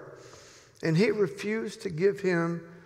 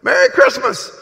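louder and higher, a loud call from a passing truck; shouted greeting; 7 semitones higher, 12 dB louder than usual. Acted. Driving past the Bible group, shouting a greeting. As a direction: yell it out the window as you drive by. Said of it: "I'd ride by".

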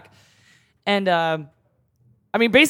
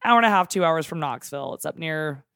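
normal talking voice

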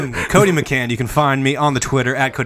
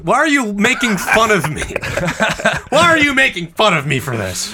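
laughing protest; 8 semitones higher than usual. Real, not acted.

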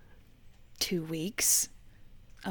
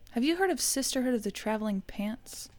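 ordinary delivery

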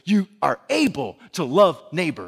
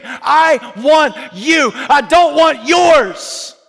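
loud, much higher, building shout; full force preaching; 9 semitones higher, 7 dB louder than usual. Acted. Preacher shouting Jesus's words to every church member. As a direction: preach it to the back pew, hammering every line.

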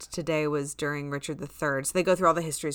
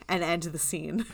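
usual speaking voice

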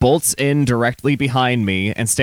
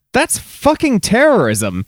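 outraged; 10 semitones higher, 4 dB louder, slower than usual. Real, not acted. Outraged reaction.

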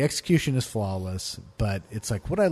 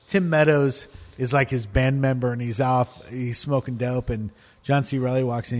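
ordinary delivery

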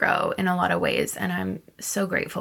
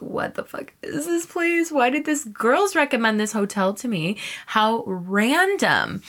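high-pitched, acted-out bewilderment; incredulous; 6 semitones higher than usual. Guest baffled by a friend's hotel recommendation, high incredulous voice. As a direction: question it in high disbelief, drawing out the end. Acting out disbelief at creepy hotel.

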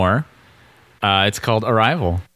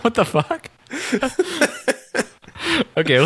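high, laughing disbelief; incredulous laughing; 13 semitones higher than usual. Real, not acted. Incredulous at the review.

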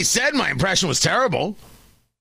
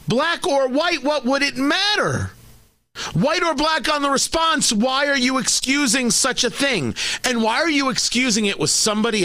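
heated; 7 semitones higher than usual. Real, not acted. Angry rant on excusing theft.

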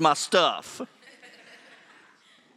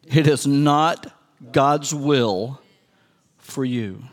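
slow solemn emphasis; 3 semitones lower, slower than usual. Acted. Slower repeat.

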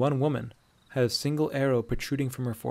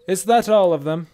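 raised, loud soldier's call; forceful Imperial officer; strong Imperial voice; 7 semitones higher, 10 dB louder than usual. A narrator's reading. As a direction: ring out like a commanding officer. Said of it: "came a strong Imperial voice".

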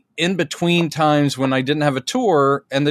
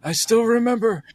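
mock tearful; 8 semitones higher than usual. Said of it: "he'll be going".

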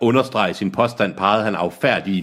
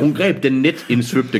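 "Hun greb den netindsvøbte kande" reread slow and higher, laughing through it; 5 semitones higher, slower than usual. Shown as a demonstration. Reader rereads the line while mocking the nets everywhere, laughing. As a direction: reread it slowly, higher, trying not to laugh. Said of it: "Hold så op med at have net på alting".

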